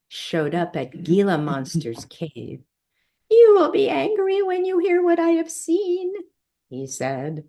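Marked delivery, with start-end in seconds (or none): 3.3-6.1 very high, quick little fly; tiny fly voice. A narrator's reading. Messenger fly brings Gila Monster bad news, high-pitched and quick. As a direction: pipe it up high and fast.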